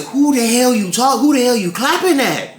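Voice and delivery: half-played outrage at the rude guest; imagined snap back. Server imagines answering the clapping guest, mock indignant. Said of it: "wanted to go ahead and just turn around and say".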